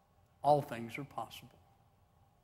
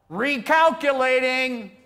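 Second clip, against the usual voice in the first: loud, high, drawn-out holler; 9 semitones higher, 12 dB louder, slower than usual. Acted. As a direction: holler it out slowly from the back of the crowd. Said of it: "somebody hollers out".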